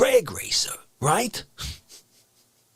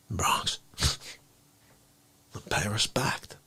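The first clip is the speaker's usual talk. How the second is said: soft, breathy whisper; 12 semitones lower, 8 dB quieter, slower than usual. Acted. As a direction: barely voice it.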